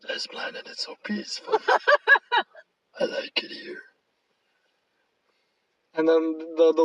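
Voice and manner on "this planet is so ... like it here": hushed, whispery monster voice; Venom impression. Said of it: "where Venom says he's okay with the world".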